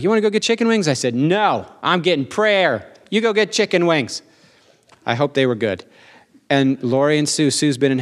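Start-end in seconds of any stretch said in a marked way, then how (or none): 0.0-0.9 lightly voiced eager friend, higher pitch; retold invitation pitched up high; Brian. Friend inviting him out for wings, lightly imitated high voice. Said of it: "Brian was like". 1.1-4.2 higher, drawn-out mock indignation; emphatic retold refusal. Turning down a friend for prayer, emphatic mock refusal.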